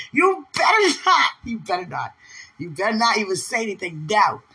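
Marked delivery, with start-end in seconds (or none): 0.1-1.3 high, emphatic mock threat; playful warning. Woman teasing her beloved not to talk, playful warning. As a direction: warn with hammed-up force.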